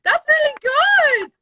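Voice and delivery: high, loud, stretched-out praise; real delighted shriek. Real, not acted. Praising her friend's English impression, delighted shout. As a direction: squeal it slowly with delight.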